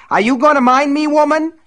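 loud high furious shout; furious yell. Acted. Buster screams orders at his wife, loud furious yell. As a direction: bark the order at her.

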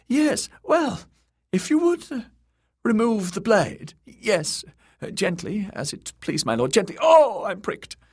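high, loud, fussing eunuch in a panic; panicky eunuch. A narrator's reading. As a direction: flutter and beg up high, then yelp. Varys begs under dagger.

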